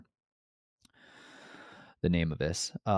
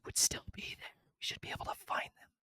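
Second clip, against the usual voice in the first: whisper; 14 dB quieter than usual. Real, not acted. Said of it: "whispered aside on sapphire pieces".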